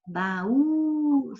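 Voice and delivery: "Baú" with the final ú held high and long; lingering stressed vowel. Shown as a demonstration. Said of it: "A mesma coisa". ("Baú" demorando no ú tônico)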